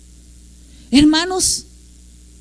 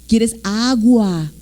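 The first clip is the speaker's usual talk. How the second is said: very slow, with AGUA drawn out; baby-talk parent; 3 semitones lower, much slower than usual. Retold or imitated. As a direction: coax a toddler, stretching "agua". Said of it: "porque hablamos chistoso con ellos". (papá que habla chistoso, alargando "agua")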